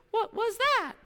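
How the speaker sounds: high, startled mock question; high-pitched mock alarm of a sister in the dark woods; scared sister. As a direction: squeal it like the frightened sister.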